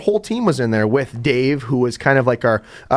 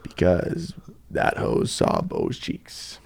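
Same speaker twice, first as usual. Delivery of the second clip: hushed punchline; 7 dB quieter, much slower than usual. Acted. Joking punchline aside.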